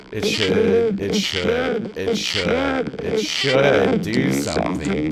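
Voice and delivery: talking through a high robotic vocoder; vocoder test. Shown as a demonstration. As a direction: repeat it evenly through a vocoder, testing.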